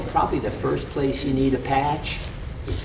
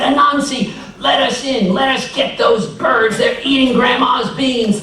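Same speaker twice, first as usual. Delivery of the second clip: high, loud, urgent calls through the door; urgent shouting; 11 semitones higher, 6 dB louder than usual. Acted. Neighbors pounding, calling in.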